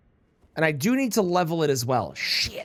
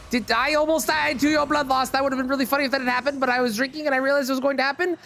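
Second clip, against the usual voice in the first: normal talking voice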